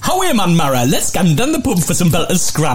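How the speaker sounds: put-on lilting Geordie dialect; Geordie accent. Shown as a demonstration. Quizmaster repeating dialect usage on request, Geordie accent. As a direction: give the line in broad Geordie. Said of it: "give it again".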